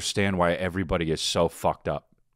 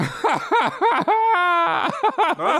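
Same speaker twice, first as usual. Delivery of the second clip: high-pitched laugh breaking through the words; cracking up mid-word; bursting out laughing; 5 dB louder, much slower than usual. Real, not acted. Cracks up at the joke.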